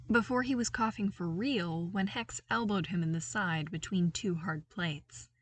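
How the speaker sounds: ordinary delivery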